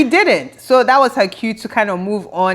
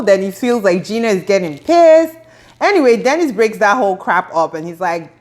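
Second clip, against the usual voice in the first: ordinary delivery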